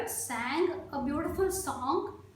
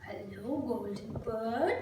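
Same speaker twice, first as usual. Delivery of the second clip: low, soft creature greeting; chameleon voice; 5 semitones lower, 4 dB quieter than usual. A narrator's reading. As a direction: creep in with a deep, gentle hello. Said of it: "The chameleon comes to the bird and says".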